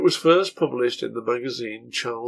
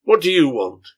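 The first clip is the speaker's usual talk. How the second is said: loud, hostile demand; aggressive; 4 semitones higher, 9 dB louder than usual. Acted. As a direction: bark it at the intruder. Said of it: "he asked aggressively".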